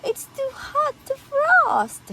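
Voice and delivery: high-pitched playful warning; mock alarm. Warning cupcakes are hot.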